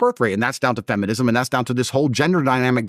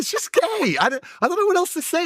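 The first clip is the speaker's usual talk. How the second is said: high mocking voice. Real, not acted.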